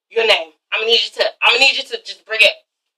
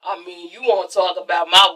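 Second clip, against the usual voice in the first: mock Countess; 8 semitones lower, 6 dB quieter than usual. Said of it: "Countess is like".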